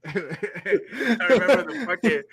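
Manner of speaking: laughing through the words, slowed; can barely get it out for laughing. Real, not acted. Laughing while recalling.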